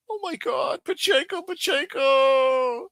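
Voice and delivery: high, far whine, last name stretched very slow; mock whining falsetto. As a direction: whimper the name mockingly. Mocking whine about Pacheco.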